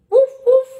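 high, loud playful woof woof; dog bark. Acted. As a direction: bark like a cheerful little dog. Dog barks in picture book.

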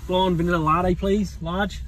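loud, matey Aussie drive-through order; Australian accent. Pretend. Ordering coffee at the drive-through, Australian accent. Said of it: "Do it in an Australian accent".

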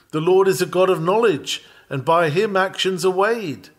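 high and loud, preached at full force; proclaimed. Real, not acted. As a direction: ring the text out to the congregation. Proclaims scripture text loudly.

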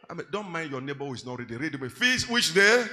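ordinary talk

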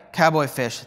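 ordinary talk, nothing marked

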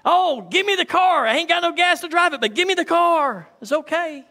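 high, eager pleading for the car; impatient believer. Pretend. Preacher mimics someone begging for a car, lightly voiced. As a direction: beg for the keys, fast and careless.

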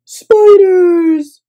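high, loud scared shout; cry of fright. A narrator's reading. Guest seeing spiders, crying out. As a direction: yelp it in horror. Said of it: "cried Mister Snore".

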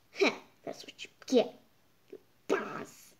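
whispered smug taunt; smug whispered dig; gloating whisper. Real, not acted. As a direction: whisper it like a snide aside. Gloating aside.

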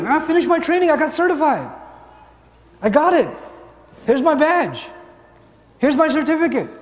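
high-pitched proud announcement; celebrating graduate. Acted. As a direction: show off your achievement, pitched high.

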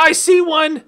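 loud, high burst of discovery; excited shout. Real, not acted. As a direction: call it out, thrilled. Spotting enemies in game.

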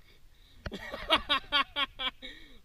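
high, staccato belly laugh; delighted laugh. Real, not acted. Angler reacts to a giant shark, laughing hard. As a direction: burst into a high rhythmic laugh.

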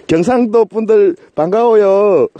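slow, prim put-on Seoul accent; mock Seoul accent. Greeting Gyeongsang viewers in a slow mock Seoul accent. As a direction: greet like a polished Seoul announcer. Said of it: "표준어 듣고".

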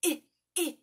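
the I said as a short clipped ih, twice; short i sound. Shown as a demonstration. Teacher modelling the short I for children. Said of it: "our I makes a".

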